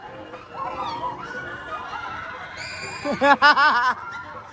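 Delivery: long high shriek with laughter; screaming laughter. Real, not acted.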